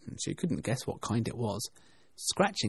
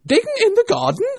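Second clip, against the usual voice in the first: very high, loud, outraged echo; angry rabbit; 13 dB louder than usual. A narrator's reading. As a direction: throw it back as an indignant, shouted question. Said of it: "said the rabbit angrily".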